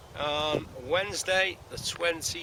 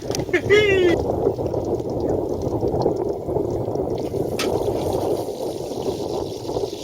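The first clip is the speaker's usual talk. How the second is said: high breathy burst of laughter; gleeful laugh; 7 dB louder than usual. Real, not acted. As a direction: cackle with delight at the find. Laughs at his own joke.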